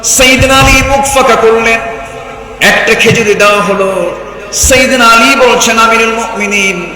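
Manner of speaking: high, loud sermon narration; full-force preaching. Acted. Preacher telling how Ali was given one date, full-force preaching. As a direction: proclaim it high and loud to the crowd.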